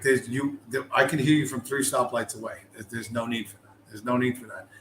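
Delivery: ordinary talk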